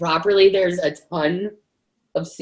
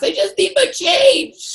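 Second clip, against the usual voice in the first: higher, slow, laughing plea for change; mock pleading voice; 11 semitones higher, 4 dB louder, slower than usual. Digging in the cash box for change, higher slow laughing plea. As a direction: whine it out like a sheepish customer.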